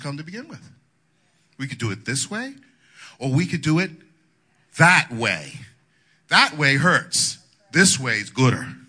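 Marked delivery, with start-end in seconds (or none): none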